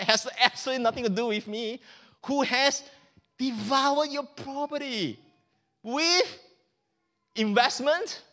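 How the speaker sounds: indignant son. Acted. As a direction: spit out his contempt for the brother. Older son rants at father.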